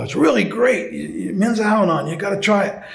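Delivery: enthusiastic urging. Retold or imitated. Man at the meeting selling his men's group to a visitor, enthusiastic urging. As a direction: talk it up with lifted pitch.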